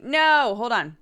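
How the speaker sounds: alarmed correction; startled no. Real, not acted. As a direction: catch a mistake suddenly. Link points to wrong item.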